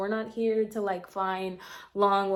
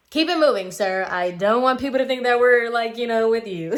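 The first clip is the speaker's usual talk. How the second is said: louder, higher, sassy dismissal of a man; brushing him off; 3 semitones higher, 8 dB louder than usual. Retold or imitated. Brushing off a pushy guy at the pool, loud sassy dismissal. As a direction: shoo him away with loud sass.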